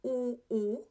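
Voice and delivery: two identical held "U, U" sounds; same pronunciation twice. Shown as a demonstration. Teacher showing two spellings sound alike, identical vowels. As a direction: say both "U" vowels exactly alike. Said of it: "the same pronunciation".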